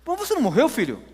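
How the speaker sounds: high-pitched disbelief, dropping on the last word; astonished questioning. Retold or imitated. Mother seeing her son alive at the door, astonished and high. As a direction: ask it in amazement, voice shooting up.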